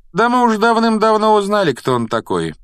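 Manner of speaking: high, loud showing off; self-important voice. A narrator's reading. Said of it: "важным голосом произнёс Рон".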